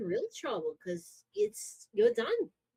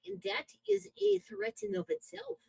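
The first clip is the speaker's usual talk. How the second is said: ordinary delivery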